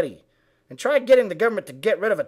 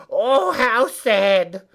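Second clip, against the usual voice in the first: very slow, drawn-out fake sympathy; mock pity; 3 dB louder, much slower than usual. Mocking liberal friends' sympathy, very slow and drawn out. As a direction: stretch it into syrupy pity. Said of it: "your friends say".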